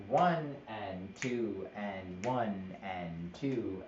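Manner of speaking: counting slowly, x0.3 pace; slow counting demo. Shown as a demonstration. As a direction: count it out slowly, each beat even. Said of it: "If I have".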